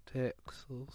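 soft, breath-only Texas drawl; hushed imitation of a Texas accent; Southern drawl. Shown as a demonstration. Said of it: "little southern drawl".

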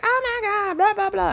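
high, giddy girlish imitation; mock girls squealing. Imitating the girls in the car, high and giddy.

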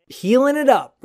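excited. Real, not acted. Excited comment.

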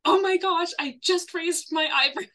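high-pitched mock exasperation; exasperated defence. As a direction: protest innocence, amused and incredulous. Acting out boyfriend's exasperated defence.

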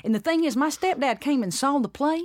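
stepdad. Shown as a demonstration. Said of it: "he talks like this".

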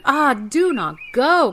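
loud, high pleading cry; wife's cry. Acted. Frightened wife begs her husband not to go, loud cry. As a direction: cry out to stop him going. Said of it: "cried the wife".

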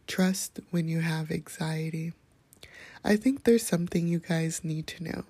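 ordinary talk, nothing marked

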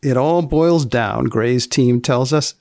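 ordinary delivery

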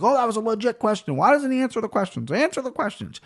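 high, indignant mock whine; mocking whiny media. Mocking media whining about a fighter dodging questions. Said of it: "They throw up their arms like".

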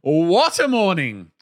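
high, drawn-out mock elation; exaggerated, singing-high delight, swooping down; overblown, high-pitched cheer, imitating a colleague; gushing morning greeting. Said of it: "And the response".

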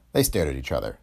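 usual speaking voice

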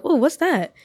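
high, nosy little question; curious startle. Retold or imitated. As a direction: perk up at a strange noise. Acted reaction to noise at door.

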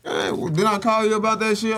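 indignant at a friend; mock outrage. Confronting Ruger about the stolen console, mock outraged.

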